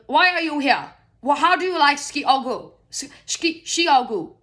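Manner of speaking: mock interrogation of Americans in a queue; pushy German guy. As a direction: quiz them like a pushy stranger. German guy quizzing Americans in line.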